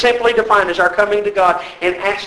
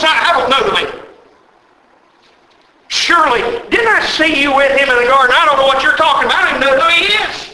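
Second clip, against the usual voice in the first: panicked denial; 4 semitones higher, faster than usual. Acted. Peter's frightened denial acted out.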